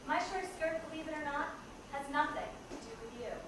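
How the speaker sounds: spoken-word poem of defiance; defiant poem recital. Acted. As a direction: recite each line as a declaration.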